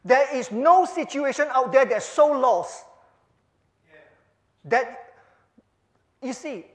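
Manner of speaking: loud, insistent preacher's exhortation; fervent preaching. Acted. Preacher warning his friends there is no fence sitting, fervent. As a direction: press the point home to the flock.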